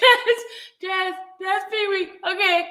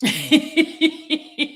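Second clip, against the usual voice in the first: laughing; 3 semitones lower than usual. Real, not acted. Loud laugh at the stream.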